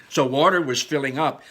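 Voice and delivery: ordinary delivery, nothing marked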